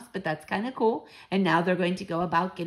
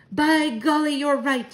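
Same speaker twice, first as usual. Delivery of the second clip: loud, higher exclamation; crying out; 8 semitones higher, 6 dB louder, slower than usual. A narrator's reading. Grandmother sees the child's point, crying out. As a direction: burst out with sudden realisation. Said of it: "she cried".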